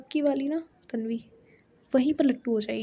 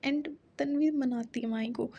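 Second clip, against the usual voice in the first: ordinary talk, nothing marked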